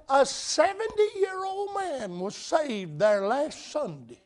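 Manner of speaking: put-on high, soft and slow old woman's voice; squeaky old lady. Preacher voicing Miss Laddie reporting news, high and soft. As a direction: tell it in her high little voice. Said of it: "high-pitched".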